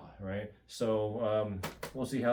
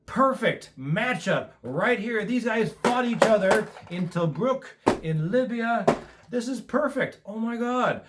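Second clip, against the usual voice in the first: much higher and louder, thrilled gush; can't contain the joy at the perfect matchup; excited; 9 semitones higher, 5 dB louder than usual. Real, not acted. Unboxing fan thrilled by matched armies, excited gush.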